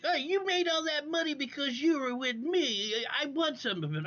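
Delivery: mocking imitation of a fellow streamer. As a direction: mimic her begging for money. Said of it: "fucking Egypt Beauty's like".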